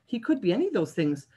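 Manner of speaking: usual speaking voice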